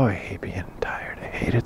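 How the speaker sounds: tired whisper to himself. Real, not acted. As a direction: breathe it out, worn out.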